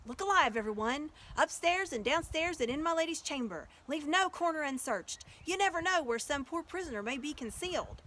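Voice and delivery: loud, high call to action; rousing command. Acted. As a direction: hurry everyone to search. Aslan orders a search.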